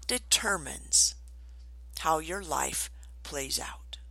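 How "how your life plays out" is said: slow, much softer whisper; whispered emphasis. Real, not acted. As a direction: sink it to a whisper. Dramatic hushed ending.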